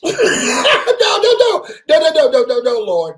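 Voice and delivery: high, loud panicked nos; frantic pleading. Retold or imitated. Begging God not to take him, in a frantic high loud voice. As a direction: beg the Lord not to take you.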